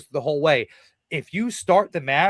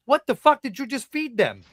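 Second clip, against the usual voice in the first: incredulous rant; 8 semitones higher than usual. Real, not acted. Ranting about a bad match.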